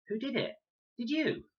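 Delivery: high, startled question; a young boy's sudden alarm; alarmed child. A narrator's reading. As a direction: blurt it out in alarm. Max alarmed in story.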